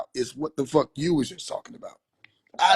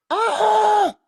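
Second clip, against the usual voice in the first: loud, much higher cartoon holler; mushroom holler; 6 dB louder than usual. Shroom hollering from the abuse, loud high yell. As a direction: cry out like a beaten mushroom. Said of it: "Shroom just hollering".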